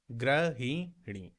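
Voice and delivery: गृहिणी said slowly as grihini; answer said slowly. Shown as a demonstration. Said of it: "कौन सा वाला है".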